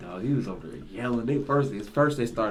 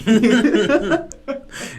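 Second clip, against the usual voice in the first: loud laugh; 13 semitones higher, 12 dB louder than usual. Real, not acted.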